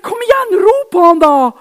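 hammed-up urging shout. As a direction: egg him on. Urging the man again.

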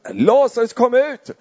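high, loud call into the dark hole; calling into the tomb. Acted. Jesus calling Lazarus out of the tomb, loud high call. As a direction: shout the name into the grave. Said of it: "han talar till Lazarus".

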